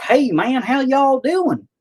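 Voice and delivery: over-the-top country bumpkin voice. Retold or imitated. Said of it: "I don't have the accent where I'm like".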